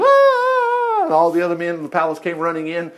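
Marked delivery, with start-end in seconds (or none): 0.0-1.0 high, loud mock scream of a woman; preacher imitating a fake scream. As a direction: shriek it like the accusing wife, hammed up. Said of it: "She began screaming".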